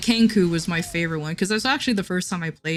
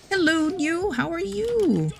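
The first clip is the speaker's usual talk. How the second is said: high, bright hello to a viewer; excited greeting; 11 semitones higher, slower than usual. Real, not acted. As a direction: greet them happily, pitched up.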